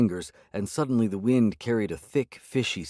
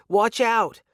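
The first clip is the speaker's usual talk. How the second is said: much higher, louder, frantic; panicked warning shout; 13 semitones higher, 6 dB louder than usual. A narrator's reading. Ada warns of attackers.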